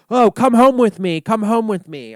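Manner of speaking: high, loud, sing-song mocking of Billy; mock naive invitation. Mocking Billy inviting the spirit home, high and loud. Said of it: "bat is like".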